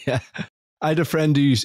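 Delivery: usual speaking voice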